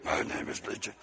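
hushed, sinister stage whisper; voiceless, very soft demon introduction. Acted. Demon's name repeated in whisper.

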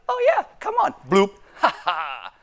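high, hammed-up ghost voice with a laugh; crazy sea ghost. Preacher mocks a sea ghost luring Peter, high and laughing. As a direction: play a goofy spook cackling at the fisherman. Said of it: "if it was a crazy sea ghost".

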